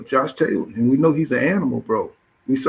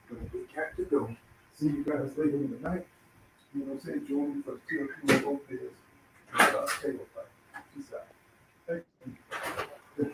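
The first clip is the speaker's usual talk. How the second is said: much softer, nearly whispered goodbye; fading sign-off; 14 dB quieter than usual. Real, not acted. Host saying bye to viewers, going soft and breathy. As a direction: drop the voice away as you sign off.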